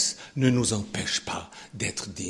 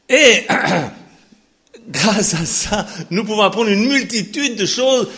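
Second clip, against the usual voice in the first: fiery preaching; 10 semitones higher, 11 dB louder than usual. Acted. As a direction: thunder God's promise to the church.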